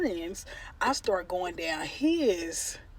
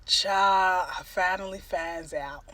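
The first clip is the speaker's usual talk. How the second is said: ordinary talk, nothing marked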